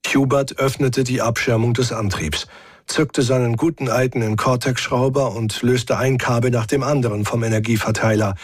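usual speaking voice

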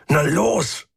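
strained, frustrated push; growled. A narrator's reading. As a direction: goad a stuck part impatiently. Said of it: "knurrte er".

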